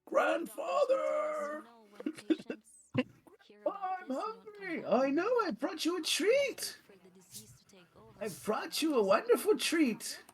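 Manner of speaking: very high, slow fuzz distortion; eerie chorus of warped voices; distorted monster voice. Acted. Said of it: "a chorus of distorted voices".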